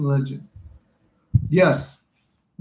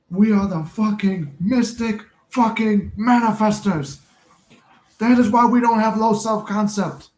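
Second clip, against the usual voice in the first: mock gangster boast; 6 semitones higher, 4 dB louder, slower than usual. Mock tough gangster declaration.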